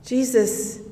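ordinary delivery, nothing marked

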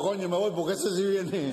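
put-on high, drawn-out drunk's punchline; retreating drunk. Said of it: "Dice el borracho".

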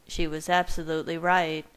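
ordinary delivery, nothing marked

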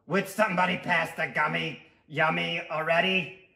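gruff little tooth character; cranky cartoon tooth; raspy Tooth voice.

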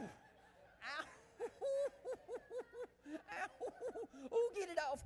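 high panicky yelps with laughter; shriek, laugh, beg someone to help; shaking off a spider. Acted. Something crawling on him, flailing.

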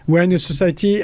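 ordinary talk, nothing marked

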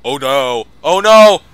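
high, loud panicked screams. Real, not acted. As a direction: scream in terror. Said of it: "I'm gonna scream like the littlest girl".

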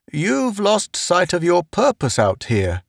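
high, solemn character voice. A narrator's reading. Murchison reminding Pace of his purpose, solemn. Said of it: "Murchison said solemnly".

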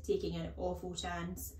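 ordinary delivery, nothing marked

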